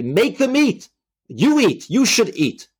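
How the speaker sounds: very high-pitched mock scream, little added volume; strained-up, insistent command in short bursts; retold screaming. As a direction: imitate furious guards screaming orders. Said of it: "And they're screaming".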